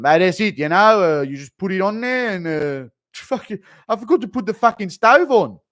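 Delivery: a confused, rambling fighter who lost the thread; sad Volk. Retold or imitated. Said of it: "Impression of sad Volk realizing he has C T E".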